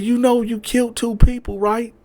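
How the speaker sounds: woman telling him he killed people, high; girlfriend informing him. Retold or imitated. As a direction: lay it on him. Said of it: "She said".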